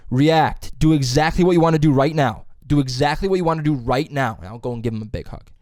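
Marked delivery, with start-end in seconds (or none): 0.1-4.4 louder, firm acting-coach command; teacher's coaching call. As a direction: urge them on like a coach. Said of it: "my teacher will guide us".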